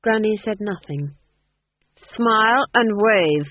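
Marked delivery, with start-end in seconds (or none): 2.2-3.5 loud, slow command; drawn-out lordly command. A narrator's reading. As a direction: order it like a duke, each word stretched. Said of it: "commanded the Duke".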